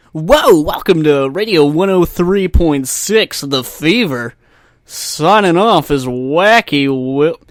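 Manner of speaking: loud, slow, booming station ident; hammed-up radio DJ. Podcaster parodying a station sign-off, hammed-up radio DJ. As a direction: belt it out like a wacky radio host. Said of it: "like it's a fucking radio station".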